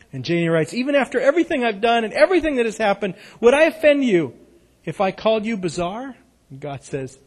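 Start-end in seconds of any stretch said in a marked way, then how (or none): 0.7-6.2 put-on girlish laughing read. A narrator's reading. Girl's line, laugh heard.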